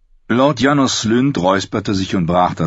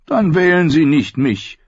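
gloomy complaint; 10 semitones higher, 3 dB louder than usual. A narrator's reading. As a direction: moan it like a born pessimist. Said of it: "klagte der schwermütige Ed".

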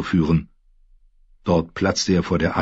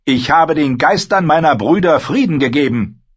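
put-on higher, louder young lord ranting; raised, frustrated voice of an embattled lord; Theon, angry and anxious; 9 semitones higher, 5 dB louder than usual. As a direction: build up the grievance, then demand. Theon proud.

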